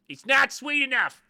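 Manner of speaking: high, full-volume yell of disgust; mock outraged taster. Host playing a commenter tasting Phish's jam, outraged yell. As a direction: shout it like an offended food critic. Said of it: "This is me tasting one of their jam".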